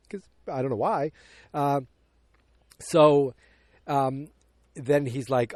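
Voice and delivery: normal talking voice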